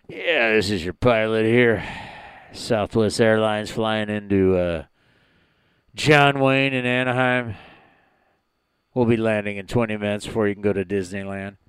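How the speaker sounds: low, lazy airline captain on the intercom; pilot voice. Pretend. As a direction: talk like the captain announcing to the cabin. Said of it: "that's my pilot voice".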